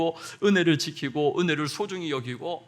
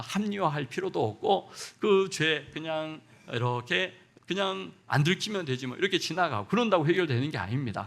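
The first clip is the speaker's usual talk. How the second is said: normal talking voice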